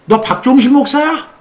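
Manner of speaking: high sneering demon voice; mocking demon.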